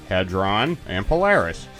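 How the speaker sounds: usual speaking voice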